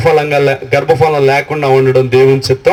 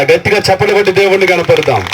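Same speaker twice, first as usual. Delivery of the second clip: ordinary delivery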